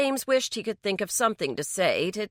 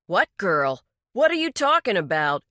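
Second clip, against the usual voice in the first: louder, rising angry questions; 6 dB louder than usual. Acted. Daryl confronts the sheriff, angry and louder. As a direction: snap back in alarm and anger. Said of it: "Daryl asked angrily".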